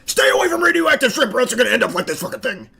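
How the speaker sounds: fast, hammed-up scare talk to toddlers; mock preschool fear speech; mock toddler warning. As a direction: scold little kids in a rush.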